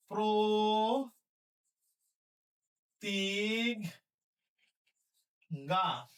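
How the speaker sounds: stretched very slow for writing; drawn-out dictation. Shown as a demonstration. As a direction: spell it out in time. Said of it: "teacher dictating word".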